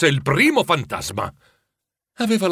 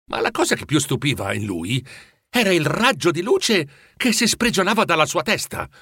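ordinary delivery

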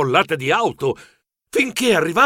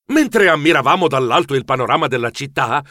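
ordinary delivery, nothing marked

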